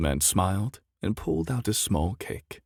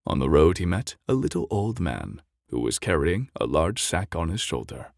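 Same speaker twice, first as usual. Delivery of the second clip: ordinary delivery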